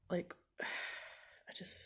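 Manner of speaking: slow, sighing whisper, trailing off. Real, not acted. Student overwhelmed by her infection, sighing in a whisper. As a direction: let the breath out and fade to a whisper.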